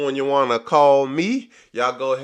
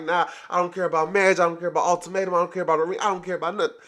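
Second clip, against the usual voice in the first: mocking Lisa; 6 semitones higher, much faster than usual. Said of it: "It's like".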